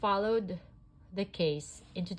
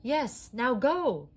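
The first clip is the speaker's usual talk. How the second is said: urgent; 7 semitones higher, 7 dB louder, slower than usual. A narrator's reading. Urging Jessie on.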